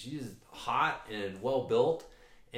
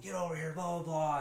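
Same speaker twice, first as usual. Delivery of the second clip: higher-pitched imitation of someone screaming; mock scream; 7 semitones higher than usual. Rider calling off her aggressive dog, mock scream. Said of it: "she's screaming at the dog".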